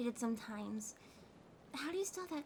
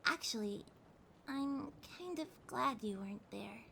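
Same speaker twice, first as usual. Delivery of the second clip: normal talking voice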